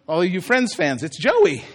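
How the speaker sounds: ordinary talk, nothing marked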